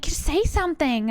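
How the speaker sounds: put-on American pleading; pushy American. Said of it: "they're like".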